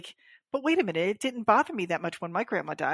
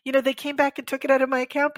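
usual speaking voice

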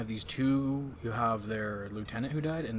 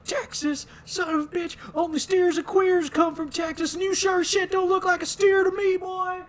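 high, loud shouted insult; drill instructor yelling; 9 dB louder than usual. Retold or imitated. Host imitating the drill instructor, shouting much higher and louder. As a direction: scream it like a drill sergeant. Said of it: "And he goes".

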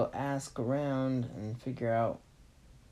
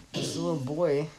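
ordinary delivery, nothing marked

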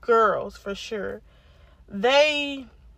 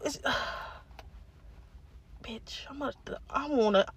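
whisper; 12 dB quieter than usual. Real, not acted. Whispered fury.

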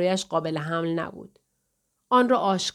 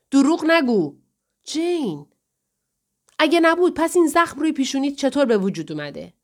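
mildly heated, raised-pitch challenge; higher, insistent quarrelling voice; accusing; 6 semitones higher, 4 dB louder than usual. Acted. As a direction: confront him over a lie. Acted quarrel, accusing husband of lying.

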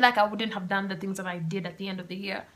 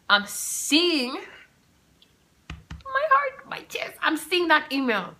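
shocked; 9 semitones higher, 6 dB louder, much slower than usual. Real, not acted. Dramatic shock retelling.

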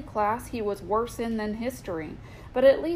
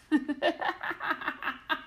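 laughing; 7 semitones higher, 4 dB quieter than usual. Real, not acted. Laughing at own joke.

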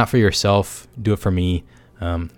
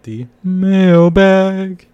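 much higher, louder, drawn-out announcer call; announcer hype; 11 semitones higher, 8 dB louder, much slower than usual. Pretend. Introducing the mailbag segment to listeners, hyped announcer. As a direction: stretch it out like a game show host. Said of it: "everyone's favorite part".

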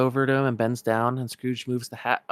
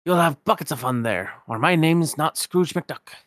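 higher, louder Scottish miser voice; indignant old Scotsman, raised and rolling; Scrooge McDuck; 5 semitones higher, 5 dB louder than usual. Acted.

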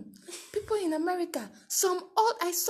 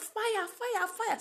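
raised, higher mock yell of fire; retold shout; 12 semitones higher, 4 dB louder, much slower than usual. Crowd yelling fire to wake the street, mock shout. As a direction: call out fire like alarmed neighbours, half-acted. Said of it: "people shout".

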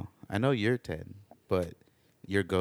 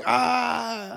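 mock pained yell; 14 semitones higher, 5 dB louder than usual. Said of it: "you were like".

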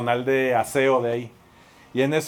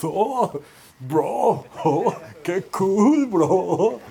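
put-on stretched, higher teen voice laughing it off; teen mates joking; 5 semitones higher, much slower than usual. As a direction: play the giggly fourteen-year-old, drawn out. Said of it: "te da risa y estás".

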